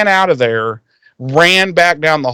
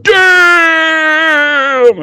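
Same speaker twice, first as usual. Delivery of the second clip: long high shouted damn; crowd shout; 13 semitones higher, 4 dB louder than usual. Retold or imitated. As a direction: holler it like a crowd. Crowd reacts to the burn.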